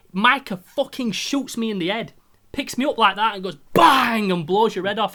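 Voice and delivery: loud, high, slowed telling of the kill; excited retelling. Retold or imitated. Telling a mate how Micah shot him, excited and loud. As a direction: relive the shock of being shot.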